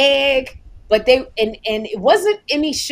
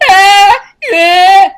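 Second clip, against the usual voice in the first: loud, high mock toddler wail; three-year-old's wail; 9 semitones higher, 13 dB louder than usual. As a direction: bawl out like a small child, hammed-up. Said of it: "I'm in my three-year-old state".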